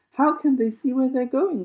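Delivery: put-on high, loud girlish shriek. A narrator's reading. Violet in the dark tunnel, shrieking. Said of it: "shrieked Violet".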